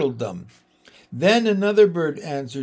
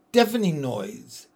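plain reading voice

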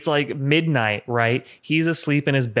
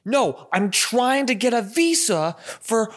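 imitating frantic Gyllenhaal; 9 semitones higher, slower than usual. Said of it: "and he's like".

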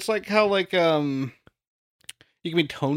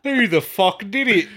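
loud, higher mock Cockney accent; exasperated hammy Cockney accent; 4 semitones higher, 5 dB louder than usual. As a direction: demand it in broad put-on Cockney accent. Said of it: "turning Cockney".

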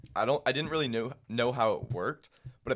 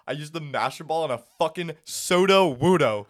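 excited; 5 semitones higher, 9 dB louder than usual. Real, not acted. Confessing Master Ball wasted on Sudowoodo.

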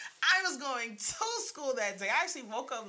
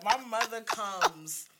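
ordinary talk, nothing marked

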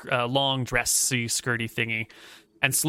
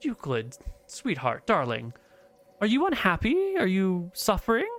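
tender; 7 semitones higher than usual. Acted. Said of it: "strokes his hair gently and says".

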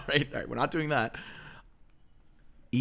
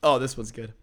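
ordinary talk, nothing marked

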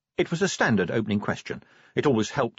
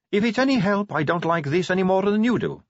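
high-pitched vampire recruit; Sally; 10 semitones higher, 4 dB louder than usual. A narrator's reading. Sally speaks to Vimes.

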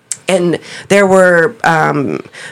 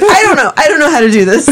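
Oscar flustered; 9 semitones higher than usual. Retold or imitated. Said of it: "and he's like".

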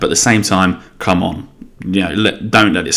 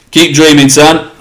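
loud, high teasing jab; mocking taunt; 7 semitones higher, 6 dB louder than usual. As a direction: rub it in, cocky and loud. Teasing a friend over fantasy football.

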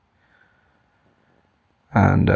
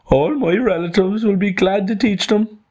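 very high-pitched animal character voice; emphatic kangaroo; 13 semitones higher than usual. Acted. Kangaroo offers his relatives as teachers, emphatic high voice. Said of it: "cried the kangaroo with emphasis".

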